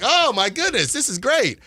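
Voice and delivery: high-pitched excited exclamation; giddy, high replay of delight; delighted reaction. Retold or imitated. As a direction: relive the thrill, pitch jumping up. Acting out delight at a touchdown.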